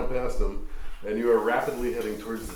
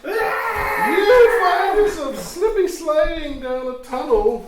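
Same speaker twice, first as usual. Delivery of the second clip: high, loud and slow, almost shouting; excited narration; 9 dB louder, much slower than usual. Acted. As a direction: swept away by the thrill of the slide. DM narrates a wild slide.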